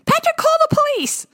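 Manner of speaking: very high, piercing shouted command; screeching order. A narrator's reading. Enraged mom screeching at husband to call police. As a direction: shriek at him to call the cops. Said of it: "she screeched".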